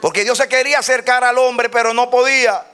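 predicar a plena voz, agudo y fuerte; prédica exaltada. Real, not acted. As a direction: proclama con fervor, alargando los vítores. (prédica a voz en cuello)

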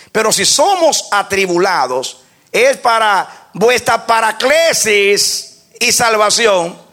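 loud, slow, emphatic preaching; loud preacher's proclamation; preaching at full force. Acted. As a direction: pound each word of the verse.

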